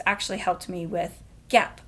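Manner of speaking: ordinary talk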